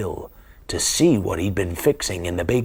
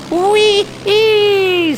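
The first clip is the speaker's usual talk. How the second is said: very high, loud gleeful squeal; thrilled whoop; 7 dB louder than usual. Acted. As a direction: whoop with pure joy. Looping the loop in the plane.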